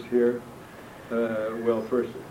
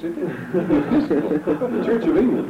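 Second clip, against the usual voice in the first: laughing; 6 semitones higher, 7 dB louder, much slower than usual. Real, not acted. Amused aside about Anglican tongues.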